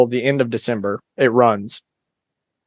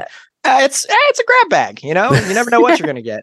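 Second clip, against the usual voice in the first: much higher sing-song tease; high teasing voice; 11 semitones higher than usual. Pretend. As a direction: pitch way up, playful. Joking about naked Jenga.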